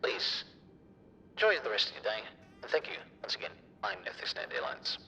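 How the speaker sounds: airport announcement. Pretend. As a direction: play a pilot on the intercom. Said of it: "That was bad, chat".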